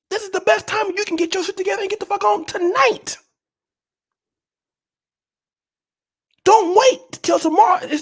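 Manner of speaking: high-pitched, insistent pleading; mock-urgent exhortation. Telling entitled subscribers to leave tonight, high mock-urgent exhortation.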